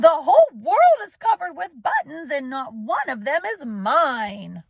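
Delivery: angry Toad screaming at the world. A narrator's reading. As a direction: scream it while jumping up and down.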